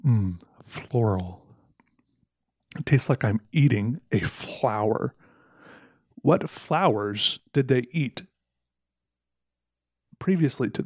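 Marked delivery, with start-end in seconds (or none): 0.0-5.2 mock coffee connoisseur. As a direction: savor it like a pretentious taster. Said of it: "the coffee and thought".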